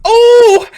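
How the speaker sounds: high, loud drawn-out oh; theatrical anguished howl; mock pain howl. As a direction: cry out at a sudden memory. Sudden memory of splinter.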